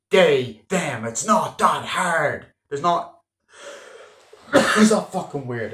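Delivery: mock exasperated teen correcting dad. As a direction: spell it out to a clueless dad, fed up. Daughter exasperated at her dad.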